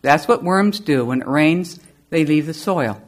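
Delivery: soft, low, calm explainer; non-panic voice. Retold or imitated. Roy calmly explains worms leave the soil, soft and low. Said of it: "in his non-panic voice".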